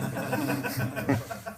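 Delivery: breathless high silent laugh; airy unvoiced chuckle; wheezy laugh. Real, not acted. After joke.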